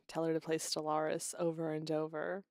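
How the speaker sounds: ordinary talk, nothing marked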